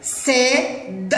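very slow, drawn out sounding; stretched out for spelling. Shown as a demonstration. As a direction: draw out أسد slowly, no long vowel. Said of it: "هيا للكلمة التالية". (نطق أسد ببطء)

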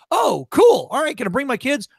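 loud, high mock delight; big put-on excitement; impressed enthusiasm. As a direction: imitate a keen, easily impressed onlooker. Imitating impressed cop in acted exchange.

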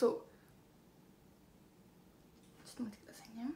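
soft whispered aside. Real, not acted. Asking viewers to wait while she checks, whispered. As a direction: hush it to the viewers.

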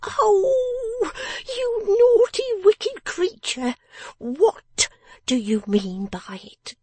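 slow, very high-pitched outraged telling-off; scolding cry. A narrator's reading. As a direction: wail each reproach at a naughty child, drawn out. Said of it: "cried the person of the house".

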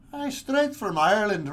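put-on playful Irish accent; a quick stage Irish lilt. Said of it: "bust out my Irish accent".

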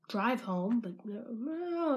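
ordinary talk, nothing marked

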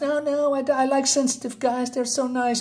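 high, sweet mocking imitation of a woman; mock woman's voice. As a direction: parody a woman insisting she likes sensitive guys. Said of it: "they can tell you otherwise".

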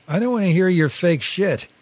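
high, loud, angry screech. A narrator's reading. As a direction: screech it at them in disgust. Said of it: "he screeched".

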